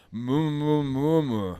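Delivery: very loud, high muffled mumble; mouth-full mumble. Acted. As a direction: force the sound out through a stuffed mouth. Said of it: "You have to keep the worms warm".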